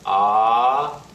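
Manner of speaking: smoothly rising "ah"; second tone. Shown as a demonstration. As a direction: lift the pitch through "ah". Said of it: "The second tone".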